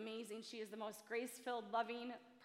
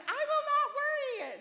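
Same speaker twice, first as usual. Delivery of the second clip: high, loud, laughing insistence; hammed-up promise not to worry, laughing; mock vow; 12 dB louder than usual. Mom mocking her own vow to her kids, laughing and loud. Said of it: "I'm like".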